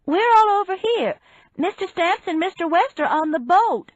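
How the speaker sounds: high, loud, carrying call. A narrator's reading. Woman directing officers over to the boat, calling out loudly. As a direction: project it far, pitched well up. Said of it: "I called".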